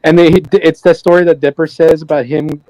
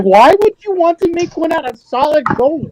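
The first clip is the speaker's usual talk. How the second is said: incredulous; 11 semitones higher than usual. Retold or imitated. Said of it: "'cause I'm like".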